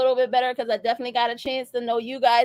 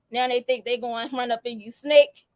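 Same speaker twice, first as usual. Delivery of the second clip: normal talking voice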